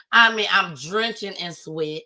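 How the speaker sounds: ordinary talk, nothing marked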